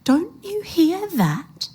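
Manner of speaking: high, slow, eerie taunt; cold. A narrator's reading. Old woman's voice carrying across the distance, slow cold menace. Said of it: "cold now, making no mistake".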